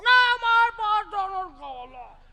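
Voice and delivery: high, slow, drawn-out loud refusal; hammed-up bratty yell; mock child tantrum. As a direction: wail it like a spoilt kid. Spoilt child refuses again, acted.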